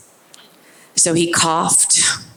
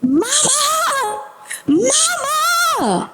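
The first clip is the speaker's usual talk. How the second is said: put-on high wailing child calling for mama; child screaming; much slower than usual. Disoriented boy crying for his mother, high child wail. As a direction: cry out like a scared toddler. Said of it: "And he is screaming".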